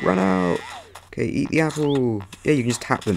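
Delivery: ordinary talk, nothing marked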